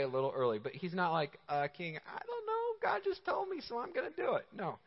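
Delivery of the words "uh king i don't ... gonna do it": much higher, soft mock-naive voice; naive blind faith. Preacher mocking a blind-faith petitioner, high soft silly voice. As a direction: play a clueless believer shrugging at the king. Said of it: "this is not blind faith".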